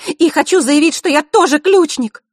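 высокий громкий вызов; заяви на весь зал; громкое заявление. A narrator's reading. Василиса заявляет перед трибунами. (громкое заявление)

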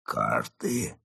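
low, soft rising question; stunned echo. Acted. Mole freezing at fox's word, stunned. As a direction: echo it softly, frozen in shock. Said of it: "Крот оцепенел".

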